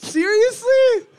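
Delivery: very high, loud, drawn-out disbelief; incredulous squeal. Real, not acted. Co-host called him an idiot, high incredulous squeal.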